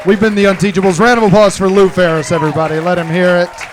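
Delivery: loud closing call to the audience; crowd sign-off. Real, not acted. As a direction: project it to the whole room. Live show sign-off to crowd.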